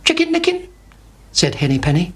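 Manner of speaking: Henny Penny greeting Chicken Licken, high and chirpy. A narrator's reading. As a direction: lift into a fussy little hen. Hen voiced in children's tale.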